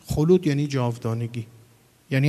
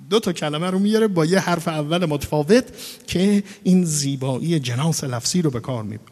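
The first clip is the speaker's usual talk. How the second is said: ordinary delivery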